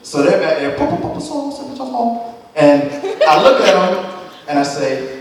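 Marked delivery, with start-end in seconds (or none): 0.8-2.3 high, soft mock foreign babble; imitated foreign chatter. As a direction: babble quietly, pitched way up, like overheard foreigners. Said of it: "they were foreign".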